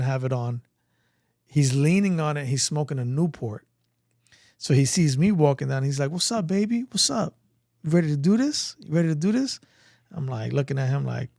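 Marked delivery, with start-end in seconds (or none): 6.1-9.6 higher-pitched, slick recruiter impression; imitated Army recruiter. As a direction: play a recruiter hailing a passerby. Acting the Army guy's greeting.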